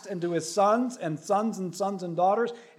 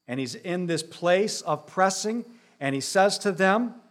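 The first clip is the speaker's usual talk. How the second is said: normal talking voice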